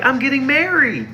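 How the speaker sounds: high mock bride voice, pitch way up; mock Gabriella. Mocking Gabriella's announcement in a high put-on voice. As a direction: squeal it like a giddy girlfriend. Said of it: "She's like".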